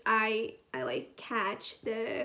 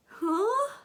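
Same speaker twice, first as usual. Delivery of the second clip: high, loud, baffled "huh?"; confused reaction; 8 semitones higher, 5 dB louder than usual. Retold or imitated. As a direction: act out being totally lost. Acts out first confused reading reaction.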